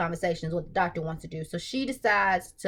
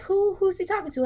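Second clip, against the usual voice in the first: alarmed; 9 semitones higher than usual. Retold or imitated. Panicked reaction while watching.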